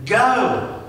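loud high call of go; God's command to go, shouted. Retold or imitated. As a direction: bark the single word go like a command. God's command, voiced big.

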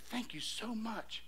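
slow, very soft thank-you; hushed thanks. Retold or imitated. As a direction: re-enact a stunned, drawn-out thanks. Retold thanks after the shock.

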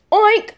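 high squeaky piglet oink. Pretend.

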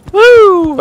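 high joyful whoop; excited whoop. Real, not acted. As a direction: cheer out loud. Celebrating passing.

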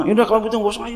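high-pitched, mock flustered parent; hasty giving in. Parent to a crying child, giving up in a hurry. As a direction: cave in to the crying.

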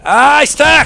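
high, loud burst of glee; excited shout. Real, not acted. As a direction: shout it out, thrilled. Grenade sticks to enemy.

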